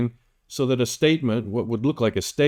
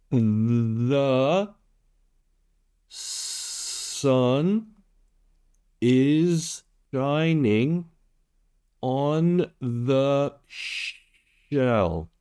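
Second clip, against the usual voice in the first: "is shining" and "on the shell" stretched out very slowly; very slow demo; 3 semitones higher, much slower than usual. Shown as a demonstration. Tutor repeating number five much slower for learners, words drawn out. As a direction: drag every sound out so learners can follow. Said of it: "One more time".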